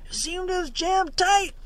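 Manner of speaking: high, strained voice from below deck; muffled reply. A narrator's reading. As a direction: answer as if shouting up from inside the hull. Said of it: "came the muffled reply".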